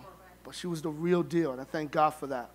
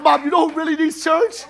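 laughing tease; 8 semitones higher, 10 dB louder than usual. Retold or imitated. Teasing mom about Petey.

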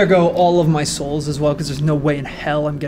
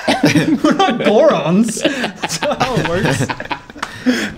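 laughing; 5 semitones higher, much slower than usual. Real, not acted. Laughing protest at Irish joke.

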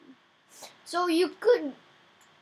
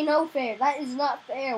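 ordinary talk, nothing marked

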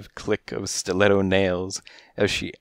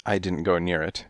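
plain reading voice

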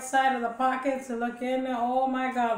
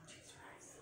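whispering; 29 dB quieter than usual. Shown as a demonstration. Whispered aside.

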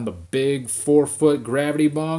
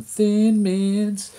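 gloating; 9 semitones higher, 5 dB louder than usual. Real, not acted. Gloating over cookie stash.

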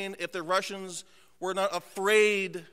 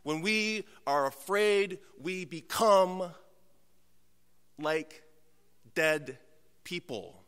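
grave slow preaching; 3 semitones lower, slower than usual. Acted. Pastor warning his congregation, slow hushed gravity. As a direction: drop to a near hush on people.

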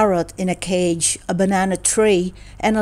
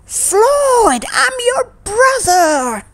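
high, loud shout, slowing on brother; 5 dB louder, much slower than usual. Acted. Angry brother shouts the news at Floyd. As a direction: yell it out in frustration. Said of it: "He shouted".

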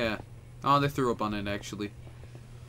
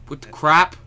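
loud, higher startled question; 5 semitones higher, 9 dB louder than usual. Real, not acted. Player startled by the game, surprised. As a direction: burst out in disbelief.

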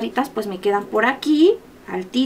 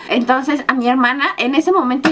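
normal talking voice

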